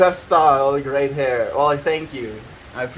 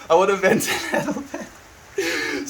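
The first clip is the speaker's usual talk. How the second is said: laughing; 10 semitones higher, slower than usual. Real, not acted. Apologising to chat, laughing.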